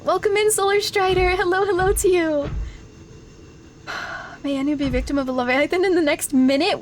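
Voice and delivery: normal talking voice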